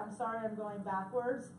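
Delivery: ordinary talk, nothing marked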